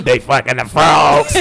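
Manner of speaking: low, loud, drawn-out ranting shout; Alex Jones. Retold or imitated. Podcaster mocking Alex Jones through an imagined voice modulator, hammed-up shout. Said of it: "Alex Jones, you doing all that".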